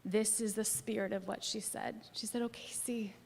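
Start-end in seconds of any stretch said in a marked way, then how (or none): 2.4-3.1 soft comforting. Retold or imitated. Friend comforting her on phone.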